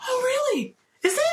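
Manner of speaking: high, sugary feigned surprise; mock innocent. Fiancee feigns innocence to her fiance, high and sweet. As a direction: bait him sweetly. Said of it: "I was like".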